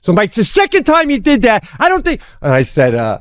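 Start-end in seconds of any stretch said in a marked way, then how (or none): 0.3-2.2 high-pitched, indignant accusation; heated, pitched-up re-enactment; retold angry yelling. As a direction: confront the careless neighbour. Retold argument with dog owner.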